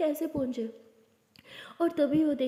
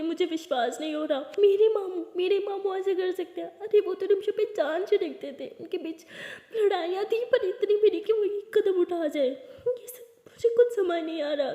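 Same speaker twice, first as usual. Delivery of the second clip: shocked disbelief; 7 semitones higher, 3 dB louder than usual. Acted. Aliya shocked by uncle's betrayal.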